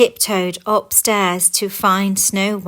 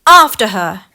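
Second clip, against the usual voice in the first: shout; 11 dB louder than usual. Acted. Dwarfs chasing queen.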